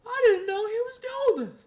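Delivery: very high, loud astonished squeal; mock surprised mom. Mom imitated finally seeing him, very high squeal. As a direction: squeal it in shock. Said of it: "She's like".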